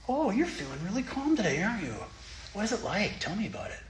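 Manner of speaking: high, sweet grandmotherly coaxing; kindly grandma. Pretend. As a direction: coo like a grandma to a child. Grandma voice to feelings.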